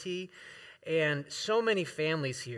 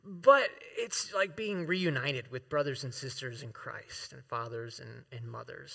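getting emotional; 4 semitones lower, 4 dB quieter than usual. Real, not acted. Pastor moved to tears by his old church, softly fading. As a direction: voice falling away to a near-hush, choked up. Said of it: "I know I'm getting emotional here".

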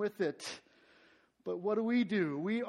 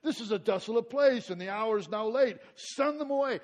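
raised, higher reading voice; scripture reading; 5 semitones higher, 8 dB louder than usual. Acted. Pastor reading the passage, raised and ringing. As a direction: proclaim the verse to the room. Preacher reads scripture aloud.

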